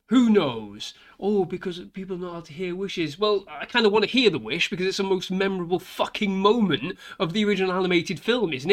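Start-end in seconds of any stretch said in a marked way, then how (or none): 1.2-3.2 mock excuse voice. Mocking the filmmakers' excuse.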